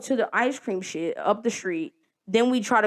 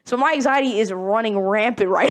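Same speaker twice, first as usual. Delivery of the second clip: ordinary delivery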